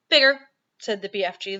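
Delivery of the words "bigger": loud, proud little boast; pleased boast. A narrator's reading. The BFG proudly bragging to Sophie about his toes. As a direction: puff up proudly and say it. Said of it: "looking rather pleased".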